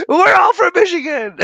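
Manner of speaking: high, loud hype yell imitating Jeff; excited shout. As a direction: shout it like a proud fan. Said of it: "there's Jeff going".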